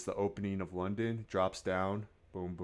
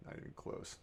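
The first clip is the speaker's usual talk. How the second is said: whispering; 14 dB quieter than usual. Retold or imitated. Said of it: "and it's like".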